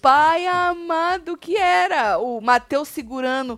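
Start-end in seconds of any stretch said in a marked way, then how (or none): 0.0-2.2 exclaiming. Real, not acted. Laughing over ugly crying.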